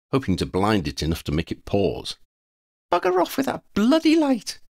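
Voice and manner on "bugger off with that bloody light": put-on very high-pitched, indignant girl; squeaky-high annoyed telling-off; girl's voice. A narrator's reading. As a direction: snap crossly in a high girlish voice. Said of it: "came a girl's voice".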